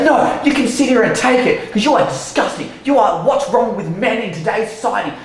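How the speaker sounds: high-pitched imitation of a disgusted woman; berating. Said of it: "Just berate, berate, berate".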